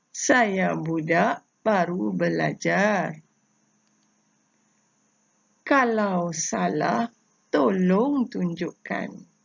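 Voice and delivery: slow, rhythmic chant of each line; pantun recitation. Shown as a demonstration. Teacher showing pupils how to read the pantun, slow recitation. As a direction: recite with even pauses between lines. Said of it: "cara cikgu membaca pantun ini".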